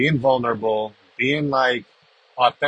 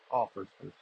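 quiet echo; 4 semitones lower, 11 dB quieter than usual. Real, not acted. Repeats line as quiet aside.

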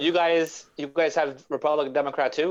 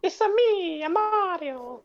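very high, sing-song game mascot voice; Mario; slower than usual. Retold or imitated. Friend quoting the game's voice actor, high mascot impression. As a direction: squeal it out in a high cartoon Italian voice. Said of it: "The guy who did that voice acting".

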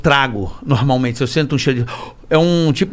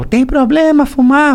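imitating a polite question; 10 semitones higher, 3 dB louder, slower than usual. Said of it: "Ele falou".

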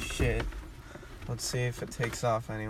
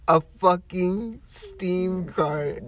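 high, slow, strained with laughter; incredulous laughing repeat; amused disbelief; 7 semitones higher, 5 dB louder, much slower than usual. Real, not acted. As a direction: drag it out, fighting back laughter. Incredulous repeat of punchline while laughing.

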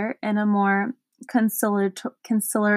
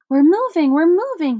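higher, louder delighted cry; happy shout; 5 semitones higher, 4 dB louder than usual. Acted. Milo thrilled the car moves again, happy shout. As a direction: cheer as the car starts rolling. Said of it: "he shouted happily".

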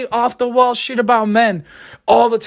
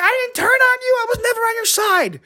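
high-pitched mock denial; high defensive mock protest; 14 semitones higher than usual. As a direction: protest it like a cornered guy. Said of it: "And I'm like".

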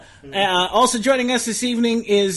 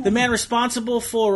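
ordinary talk

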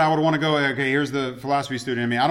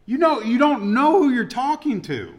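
Jesus' wry comeback, pitch lifted high; almost sarcastic; 8 semitones higher, 3 dB louder than usual. Retold or imitated. Jesus answering the doubting father, almost sarcastic. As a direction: tease the question with mock disbelief. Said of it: "almost sarcastically".

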